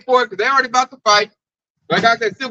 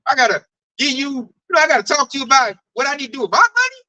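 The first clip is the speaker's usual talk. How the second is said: usual speaking voice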